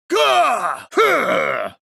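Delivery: high grunts into a big booming laugh; hearty grunt and laugh. Acted. Jovial strongman reacting to a blow, then laughing heartily. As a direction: bark out then roar with laughter.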